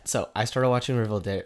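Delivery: ordinary talk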